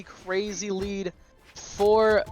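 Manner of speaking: normal talking voice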